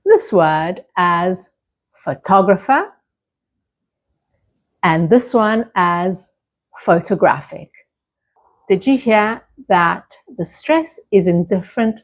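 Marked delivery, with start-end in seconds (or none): 1.9-2.9 photographer stressed on TOG; second-syllable stress. Shown as a demonstration. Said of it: "like photographer". 6.8-7.7 photographic stressed on GRAPH; careful model photographic with the beat on GRAPH; stress near the end. Shown as a demonstration. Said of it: "photographic instead is right at the end".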